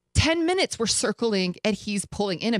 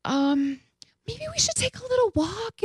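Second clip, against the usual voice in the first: hushed, high, hesitant suggestion; nervously nudging the sick date away from the crowd; tentative whisper. Retold or imitated. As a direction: whisper it gingerly, much higher. Awkwardly suggesting walk.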